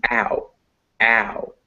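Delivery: "ow" as a gliding into a weak U; diphthong demonstration. Shown as a demonstration. Teacher showing what the vowels make, gliding "ow". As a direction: say "ow" as two vowels joined. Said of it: "This is a and this weak U".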